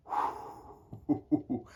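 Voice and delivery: long airy exhale, then hoots; awed whew. Real, not acted. Book reviewer overwhelmed by a favourite book, awed whew. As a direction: blow out a breathy "whoo" in admiration.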